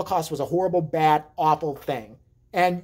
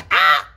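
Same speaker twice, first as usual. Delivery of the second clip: put-on shocked baby yelp; 10 dB louder than usual. Brother shocked by cold water.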